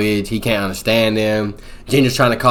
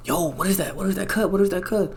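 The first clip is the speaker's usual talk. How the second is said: higher, quieter worried asking; Ginger worried; 7 semitones higher, 11 dB quieter than usual. Retold or imitated. Ginger asking Blake about the cut, hushed and higher. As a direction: ask it high and hushed, concerned. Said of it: "Ginger's like".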